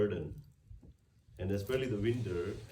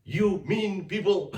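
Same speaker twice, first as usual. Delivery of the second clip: mock angry God; 7 semitones higher, 7 dB louder than usual. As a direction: ham up a stern punishing God. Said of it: "He didn't tell ... No, he didn't say that".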